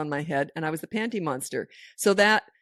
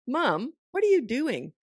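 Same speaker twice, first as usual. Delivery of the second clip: slow, higher-pitched imitation of a disapproving daughter; retold singsong reproach; mock scolding; 5 semitones higher, slower than usual. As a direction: draw it out as if catching Mom out. Daughter catches mom slacking.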